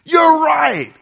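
loud, high, rowdy bro yelling; macho hype shout. Retold or imitated. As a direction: hype up the guys to go wreck something. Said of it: "as men, that's how we feel".

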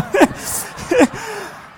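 high loud burst of laughter; real helpless laugh. Real, not acted. Pastor cracks up telling the campfire story, loud laugh. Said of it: "I lost it".

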